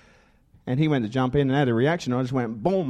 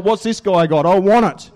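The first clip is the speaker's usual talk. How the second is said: loud, eager wanting; 4 semitones higher, 7 dB louder than usual. Retold or imitated. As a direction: grab at it excitedly. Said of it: "I thought".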